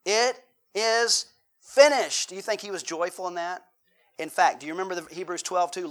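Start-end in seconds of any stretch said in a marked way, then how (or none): 0.0-2.3 slow, loud and higher proclamation of finished; loud cry. Retold or imitated. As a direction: call it out slowly with full voice. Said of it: "with a loud voice".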